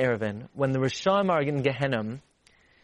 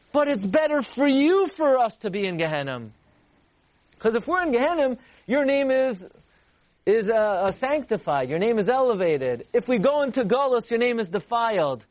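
impassioned plea; 6 semitones higher than usual. Acted. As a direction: plead with full passion.

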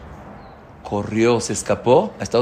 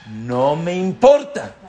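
defiant retort; 4 semitones higher, 5 dB louder, slower than usual. Retold or imitated. Stubborn man's defiant answer.

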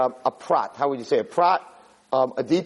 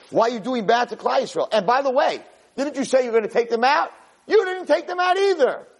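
pitched-up retold protest, rising to a high peak; yelling reproach; 7 semitones higher than usual. Moshe takes God to task for the nation, yelling. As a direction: build to an indignant accusation. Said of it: "you're yelling at me".

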